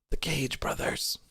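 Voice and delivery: hushed unvoiced whisper; dramatic whisper. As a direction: whisper it like a big secret. Mock-dramatic joke reveal.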